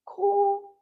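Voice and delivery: usual speaking voice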